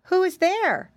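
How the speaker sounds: put-on high-pitched little boy calling. A narrator's reading. Piggy boy calls to the crying voice, calling out. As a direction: call into the woods to whoever is there. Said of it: "he called out".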